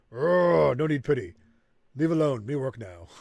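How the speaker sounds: a hint of a proud orc pushing pity away; gruff, short refusal; mild surly orc; lightly voiced orc. Orc rejects pity again.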